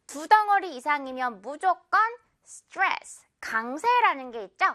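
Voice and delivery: usual speaking voice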